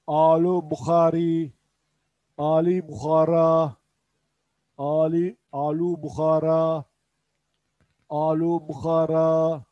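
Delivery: alu Bukhara said slowly with a throaty kh; slow model phrase. Shown as a demonstration. Teacher modelling alu Bukhara slowly to class. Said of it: "Erik derken alu Bukhara".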